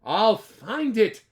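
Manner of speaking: loud, slow determined cry; cried out. Acted. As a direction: vow it out loud. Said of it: "cried Horton".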